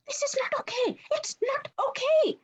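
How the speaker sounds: high, strained panic; fretting up in pitch, slowing; panicking. Acted. Mike panicking at the scene.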